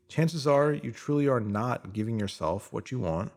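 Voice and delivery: normal talking voice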